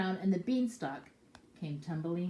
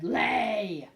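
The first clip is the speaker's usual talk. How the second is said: ogre's command; 3 semitones higher, 7 dB louder than usual. Acted. Ogre commands the hen.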